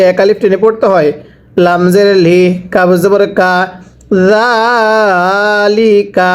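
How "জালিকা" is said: জালিকা held long and very slow; slow drawn-out reading. Shown as a demonstration. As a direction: model জালিকা slowly for the class. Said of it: "একসাথে পড়বেন".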